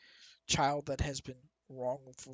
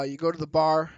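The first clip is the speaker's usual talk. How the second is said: usual speaking voice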